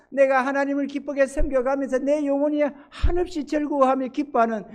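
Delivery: high, sustained pulpit voice; fervent preaching. Acted. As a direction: carry the congregation along in fervent proclamation.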